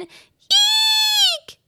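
piercing very high scream; mother shrieks at a mouse, very high and loud. A narrator's reading. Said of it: "screamed the boy's mother".

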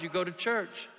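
usual speaking voice

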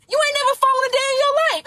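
hype. Real, not acted. Hyped motivational opener.

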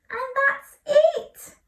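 high, loud, slow ta-da; cheerful finish. Real, not acted. Storyteller announcing the story's end to children.

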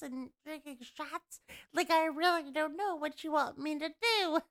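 inhaled ditzy-blonde voice; playful alter ego, unlike your own voice; put-on character voice. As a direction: play a dumb blonde, air sucked in. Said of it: "talk, talk like that".

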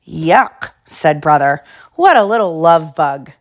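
lively storybook reading; reading to children. Acted. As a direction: read it like bedtime story. Reads picture book aloud.

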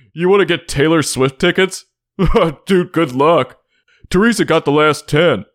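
high, dismissive know-it-all friend; naysaying buddy voice. Pretend. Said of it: "that one person who shits on your ideas".

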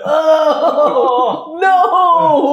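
high, loud mock horror; hammed-up alarmed cry; mock panic. Mock panic at Strahd sensing.

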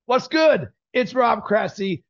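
"What's good?" starts high and drops on GOOD; positive inflection. Shown as a demonstration. Coach shows client his scripted show opener, positive inflection on "What's good?". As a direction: open with an upbeat lift on "What's good?". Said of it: "what's good is a positive inflection".

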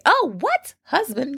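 high squeal of disbelief; mock surprise. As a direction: gasp like it's news.

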